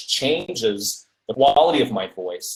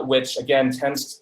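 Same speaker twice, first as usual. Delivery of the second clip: usual speaking voice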